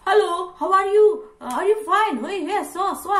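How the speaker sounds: high, loud, drawn-out gushing greeting; expressive American style. Acted. American-style greeting to a friend, loud and expressive. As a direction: gush like an over-friendly American. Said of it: "Kalau tipe American style kan".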